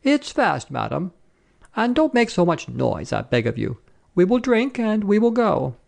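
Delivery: high, insistent protest; pleading soldier. A narrator's reading. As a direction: insist politely, pitch jumping up. Soldier pleads with the scolding woman.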